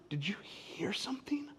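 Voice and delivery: gasp, then hushed question; breathy storybook whisper; suspenseful whisper. Acted. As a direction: whisper it as if listening in the dark.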